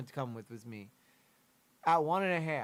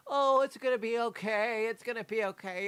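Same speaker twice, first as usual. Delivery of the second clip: higher, hammed-up voice of relatives comforting a child; mock soothing relatives; 8 semitones higher than usual. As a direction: mimic the family cooing that everything is fine. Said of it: "acting like".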